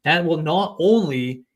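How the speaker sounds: ordinary talk, nothing marked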